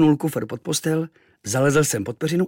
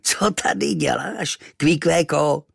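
slow, loud, higher amused voice; laughing native; 4 semitones higher, 3 dB louder than usual. Acted. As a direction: chuckle at the boy. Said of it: "rozchechtal se".

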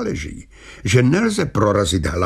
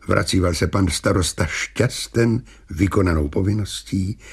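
usual speaking voice

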